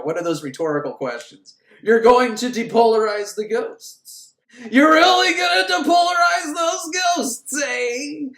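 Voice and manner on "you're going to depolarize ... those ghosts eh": builds from slow taunt to a high, loud shout; hammed-up rising outrage; mock accusing shout. [name] confronting, building to shout.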